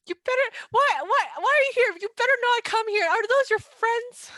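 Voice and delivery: high, loud furious yelling; outraged bride. Acted. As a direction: yell at the crashers to get out. Bride outraged at wedding crasher.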